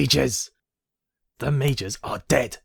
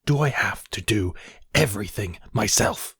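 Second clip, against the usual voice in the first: ordinary delivery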